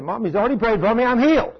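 put-on high, bright child insisting; insistent little boy. Preacher voicing Cody telling his mom he's healed, high.

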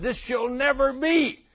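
high, loud voice of a protesting disciple; Peter protesting. Retold or imitated. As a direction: Peter pulls Jesus aside, pleading in a high, loud voice. Peter protests Jesus' death.

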